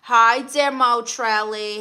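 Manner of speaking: normal talking voice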